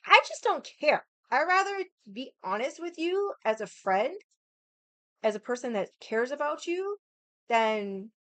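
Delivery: high, loud blunt insistence; heated. Real, not acted. Telling the brother she speaks bluntly, heated and high. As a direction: declare it proudly and hot.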